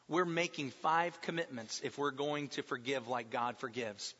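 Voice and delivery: ordinary talk, nothing marked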